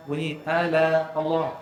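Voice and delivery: ordinary delivery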